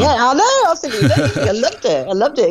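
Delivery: high, laughing delight; bubbling over with glee. Real, not acted. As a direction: gush with laughter through it. Delighted about the camper-van lady.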